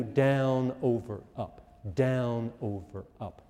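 slowly spelled-out down over up; teacher counting out conducting beats; slow counted pattern. Shown as a demonstration. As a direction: talk them through the beat pattern slowly. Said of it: "And for triple".

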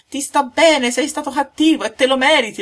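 high, loud, scolding little cricket; a tiny insect wagging its finger; high tiny-creature reproach. A narrator's reading.

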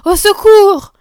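high, loud call for help; alarmed villagers' shout; women crying out. A narrator's reading. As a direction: yell for rescue. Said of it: "criaient les bonnes femmes".

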